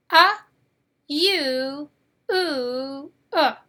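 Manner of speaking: "Ah, U, ooh, uh" spaced out, "Ah" punched loud; U vowel sounds. Shown as a demonstration. Teacher drilling the four U sounds, loud first. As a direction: sound out each U vowel apart. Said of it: "Can you say those four sounds?".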